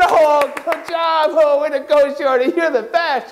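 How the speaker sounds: high drawn-out sarcastic praise; mock praise. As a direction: pour on fake admiration. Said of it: "They said".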